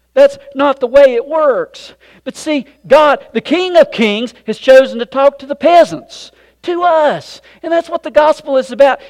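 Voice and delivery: loud, high, driving sermon voice; full-force preaching. Acted. Preacher proclaiming to the congregation, loud and high. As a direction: preach it out to the back row.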